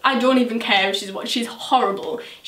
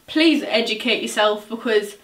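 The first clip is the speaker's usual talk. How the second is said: usual speaking voice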